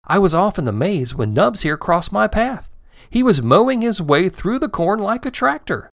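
put-on boy explaining how he met Nubs, excited; boy's voice. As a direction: tell it like an excited kid.

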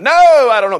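loud, high-pitched protest; mock-indignant outburst of denial; emphatic denial. Preacher acts Peter's repeated denial, loudly insistent.

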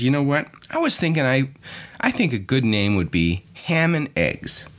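lightly voiced chicken thinking up a name; pondering chicken. Chicken suggests a restaurant name to pig, pondering. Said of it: "the chicken ponders for a bit and he says".

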